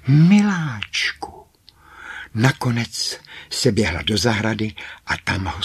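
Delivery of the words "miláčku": high, loud drawn-out call; long wailing call, pitch soaring then falling. A narrator's reading. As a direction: cry out for a missing loved one. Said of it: "i volala".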